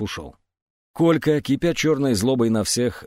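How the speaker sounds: ordinary delivery, nothing marked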